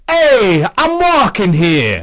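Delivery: loud, high, drawn-out New York Italian holler. Acted. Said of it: "New York Italian. It's like".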